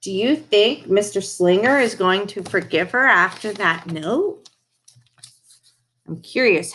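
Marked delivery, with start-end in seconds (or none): none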